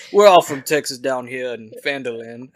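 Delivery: loud joking Texas drawl; mock Southern twang, played loud. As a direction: laugh into a put-on Texan accent. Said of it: "You Southern from Texas there".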